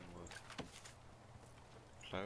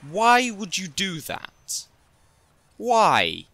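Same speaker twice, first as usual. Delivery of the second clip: much higher, louder disbelieving yell; loud, slowed, rising cry of disbelief; exasperated shout; 12 semitones higher, 13 dB louder than usual. Real, not acted. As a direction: scream at them in outrage. Outraged at prisoners given computer.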